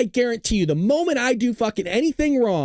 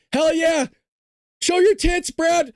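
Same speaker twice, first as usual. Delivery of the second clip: mock crowd holler, pitched up; rowdy heckling; 6 semitones higher, slower than usual. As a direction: whoop like a rowdy audience member, playfully. Pretending to be a rowdy donor.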